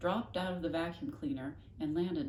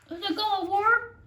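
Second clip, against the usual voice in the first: anxious eager question; 11 dB louder than usual. Real, not acted. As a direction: blurt it out hopefully. Listener child asks.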